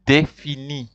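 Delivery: défini said the French way, day-fee-nee; French word. Shown as a demonstration. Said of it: "you say article".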